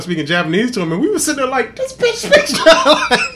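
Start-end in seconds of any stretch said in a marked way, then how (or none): 1.8-3.3 high, loud and slowed, laughing in disbelief; amazed disbelief. Retold or imitated. Amazed at the woman speaking Japanese, laughing disbelief. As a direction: stretch it out, stunned and laughing.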